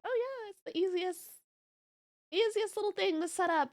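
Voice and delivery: high, airy mock voice; mocking naive. Aunt mocking people who think VTubing is easy, high airy. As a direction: parody the clueless dismissive type.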